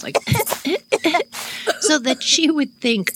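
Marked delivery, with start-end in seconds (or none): 0.2-1.8 high fake coughing; imitated cough. Kids hiding under a tree, faking coughs. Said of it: "started making coughing sounds".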